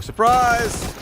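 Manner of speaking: high, loud cry of surprise; sudden high-pitched surprise call; surprised yell. Real, not acted. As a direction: shout it out in shock. Caster reacts to surprise ambush.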